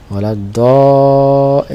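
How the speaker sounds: ওয়ালাদ-দ- with the last vowel held long; slow recitation. Shown as a demonstration. Teacher stops mid-verse to show alif, reciting slowly. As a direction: stretch the vowel, then break off.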